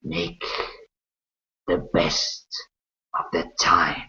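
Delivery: slow, hushed otherworldly voice, long pauses between phrases; shadowy creature. Acted. Shadow creature reminding you of the contract, slow and whispery. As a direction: whisper it slowly, like a shadow delivering terms.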